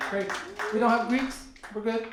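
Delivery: ordinary talk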